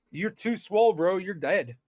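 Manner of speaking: ordinary talk, nothing marked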